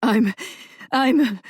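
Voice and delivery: high, gasping bursts; boy hyperventilating, can't speak; hyperventilating gasps. Acted. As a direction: choke on each word, no breath. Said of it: "quick, high-pitched bursts".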